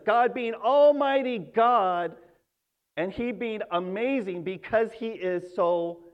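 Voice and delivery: ordinary talk, nothing marked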